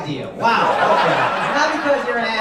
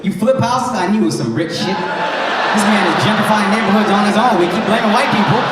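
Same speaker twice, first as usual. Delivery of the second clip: ordinary delivery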